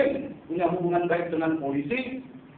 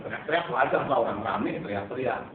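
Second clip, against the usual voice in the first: normal talking voice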